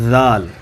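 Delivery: ذال with the tongue at the teeth; letter demo. Shown as a demonstration. Teacher models ذال. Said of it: "ذال کے لیے آپ کی آواز اس طرح ہوگی".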